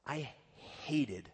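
"hated" drawn out slowly after a pause; stress on "hated". Real, not acted. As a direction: set "hated" apart and stretch it. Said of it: "I really want to emphasize that word".

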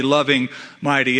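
ordinary delivery

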